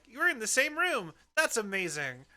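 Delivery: high, thrilled dog's voice; delighted pug. Retold or imitated. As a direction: gush like an easily amazed pug. Voicing a delighted pet pug.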